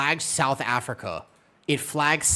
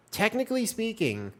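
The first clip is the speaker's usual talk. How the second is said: higher, exaggerated know-it-all emphasis on technically; mock pedantic; 12 semitones higher than usual. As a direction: play the smug nitpicker, lifting the pitch. Pedantic repeat of a phrase.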